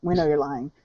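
soft, low, tactful murmur; polite aside. Retold or imitated. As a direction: say it gently and low, as if sparing his feelings. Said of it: "trying to be polite".

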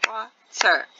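WATER broken as WA ... TER; WATER with a gap after WA; clapped-out syllables. Shown as a demonstration. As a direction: give WATER one beat per syllable, WA, then TER. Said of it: "When you clap it out".

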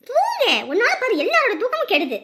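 loud, high burst of annoyance; irritated scolding. Acted. Woman blaming the child for everyone's lost sleep, irritated scolding. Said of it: "எரிச்சலா இருக்குப்பா".

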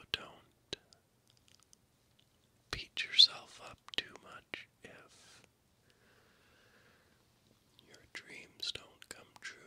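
normal talking voice

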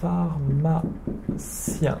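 "Pharmacien" drawn out very slowly and softly; very slow repetition. Shown as a demonstration. A teacher repeats "Pharmacien" very slowly for students. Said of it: "Mazu, le".

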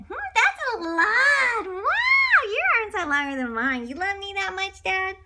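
high, loud wow of wonder; amazed little bunny. Acted. Little bunny amazed at dad's arms, high and loud. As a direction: gasp in delight at dad's long arms.

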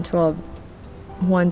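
ordinary delivery

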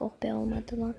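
ordinary delivery

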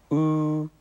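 "う" with lips pushed far forward, high, loud and level; puckered Mandarin u. Shown as a demonstration. Teacher modelling the u vowel, puckered high "う". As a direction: pucker hard and sustain a high "う". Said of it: "前に突き出してすぼめてますね".

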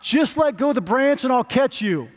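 high, loud booming voice from above; lightly voiced God calling down; God answering the man on the cliff, calling down. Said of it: "he hears this voice".

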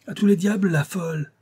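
high, loud angry outburst; furious. A narrator's reading. Colonel cursing the mad girl, furious. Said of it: "s'écria le colonel en fureur".

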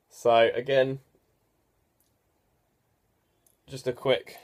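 chin strap buckled tight; talking through face cage; wearing a football helmet. Real, not acted. Seen 2: Helmet with face cage and chin cup worn throughout; absent in references.

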